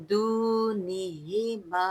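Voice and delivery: slow chanted verse with long vowels; tajweed recitation. Shown as a demonstration. Teacher reciting the verse for students, slow melodic chant. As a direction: chant each word slowly and melodiously. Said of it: "इसको भारी पढ़ना है".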